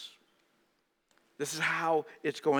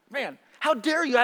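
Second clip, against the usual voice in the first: mock outrage; 10 semitones higher, 5 dB louder than usual. Disciples' angry retort.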